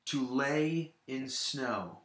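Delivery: slow "To lay in snow", beats on "lay" and "snow"; stress on LAY and SNOW. Shown as a demonstration. Teacher showing the same pattern, slow stress on lay and snow. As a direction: draw out "lay" and "snow", keep "To" and "in" light. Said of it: "follow the exact same pattern as the first one".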